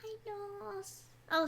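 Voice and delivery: ordinary delivery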